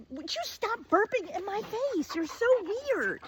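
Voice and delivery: high mocking voice of an irritated older man; annoyed boyfriend. Older boyfriend scolds girlfriend for burping in his face, in a high mocking voice. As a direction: squeal it like a fed-up grown-up scolding a kid.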